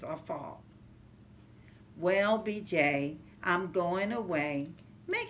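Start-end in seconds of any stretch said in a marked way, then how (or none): none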